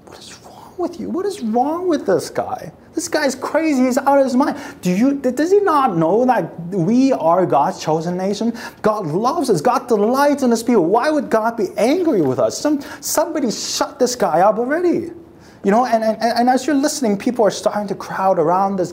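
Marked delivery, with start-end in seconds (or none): 0.0-1.1 hushed, breathy disbelief to a neighbour; whispered outrage; whispered aside. Retold or imitated. Crowd reacting to prophet. 1.1-15.1 scoffing worshipper. Retold or imitated. Crowd scoffing at Jeremiah.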